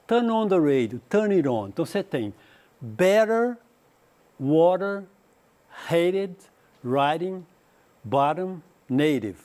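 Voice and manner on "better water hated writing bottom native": T softened to a quick d in better, water, hated, writing, bottom; American flapped T. Shown as a demonstration. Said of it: "que é o inglês americano".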